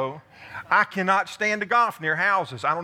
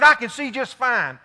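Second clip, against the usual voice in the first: a little louder, cocky and sure; imitating Howard, louder; 5 semitones higher, 6 dB louder than usual. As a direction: brush off the worry, a little louder. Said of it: "He said".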